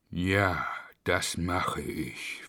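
low, soft zombie reply; evil zombie. Acted. Zombie Schnuffel obeys the monster, low and soft. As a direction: answer like a hollow zombie. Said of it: "versprach Schnuffel".

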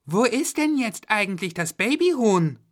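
put-on high child's voice; Florian asking. A narrator's reading. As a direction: ask curiously as a kid. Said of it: "Da fragte Florian noch".

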